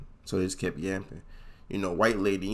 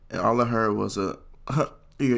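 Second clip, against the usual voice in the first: ordinary talk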